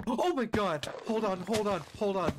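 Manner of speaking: high, shocked oh my God. Real, not acted. Gamer spots something alarming, high shocked exclamation. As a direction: react to the shock on screen.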